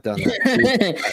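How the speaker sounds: laughing. Real, not acted. Joking about blasting people before.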